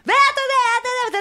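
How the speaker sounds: high-pitched imitation of a screaming mother; mock screaming. Mother resuming her fight after serving, imitated screaming. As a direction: burst back into a shrieked mock tirade. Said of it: "וצורחת".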